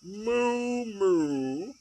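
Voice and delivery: long mooing like a cow. Acted. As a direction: moo it like a bored cow. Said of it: "All she heard was".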